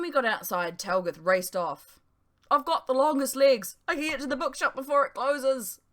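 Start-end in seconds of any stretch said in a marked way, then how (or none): 2.5-5.8 high, loud yell while running off; yelling over shoulder. A narrator's reading. Talgoth yelling back to friends, excited shout. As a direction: shout it back as you race away. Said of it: "he yelled over his shoulder".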